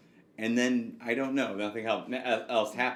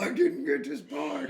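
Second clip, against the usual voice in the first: hammed-up high cartoon voice; slower than usual. Imagined lawyer bursting through wall.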